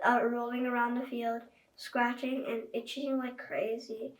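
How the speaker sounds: plain reading voice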